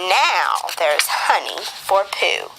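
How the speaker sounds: usual speaking voice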